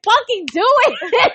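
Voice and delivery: high-pitched goading with a laugh in it; retold dare. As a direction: egg him on, hammed-up and half laughing. Mother daring son to hit her.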